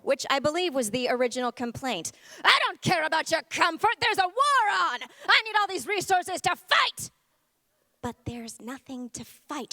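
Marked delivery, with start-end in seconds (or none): 2.5-7.1 general. Acted. Adrenaline general replying.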